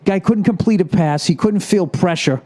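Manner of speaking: ordinary delivery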